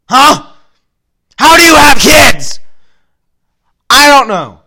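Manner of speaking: high, loud mocking yell; angry shout. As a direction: scream it at her. Retorting to teacher in anger.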